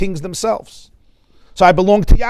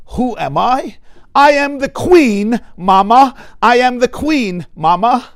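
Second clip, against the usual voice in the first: raised, emphatic voice of an affronted queen; indignant queen; 5 semitones higher, 5 dB louder, slower than usual. Acted. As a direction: play the queen putting her mother in place. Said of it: "So she looks at her mother and says".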